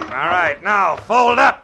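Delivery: loud, high and slow finishing order; straining shout mid-fight; shouted command. Acted. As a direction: put him down with a yell. Detective finishes off thug in fight.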